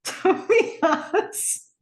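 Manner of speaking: said through laughter, high and slow; can't stop laughing as you say it; giggling disbelief. Real, not acted. Laughing while commenting on scene.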